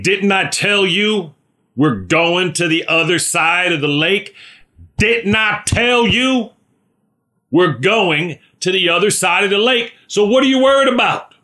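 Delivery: Jesus chiding the frightened disciples, exasperated and rising. Acted. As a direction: ask them how they could doubt, climbing to a near shout. Voicing Jesus scolding disciples.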